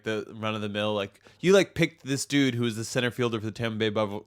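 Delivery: ordinary talk, nothing marked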